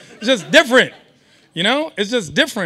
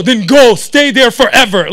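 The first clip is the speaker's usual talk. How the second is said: mock angry dad; 8 dB louder than usual. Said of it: "And then I'm like".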